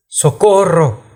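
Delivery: high, stretched-out cry of Socorro; calling out for rescue, pitched well up; cry for help. A narrator's reading. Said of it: "le entendí gritar diferentes veces".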